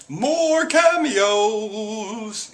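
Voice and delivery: very slow, high, stretched-out fanfare; hammed-up drawn-out excitement; hyped announcement. Shown as a demonstration. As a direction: draw it out like a big reveal. Hyped announcement of next segment.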